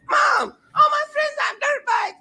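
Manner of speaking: put-on whiny kid in a very high falsetto; high-pitched mock crybaby voice; Tobias. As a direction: plead to mom like a whining child. Voices Tobias whining to his mom.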